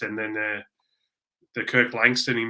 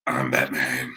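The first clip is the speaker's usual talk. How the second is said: deep gravel-free low Batman voice, far below his own; Batman impression; 12 semitones lower, slower than usual. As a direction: drop way down for a low, brooding Batman. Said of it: "your best Batman impersonation".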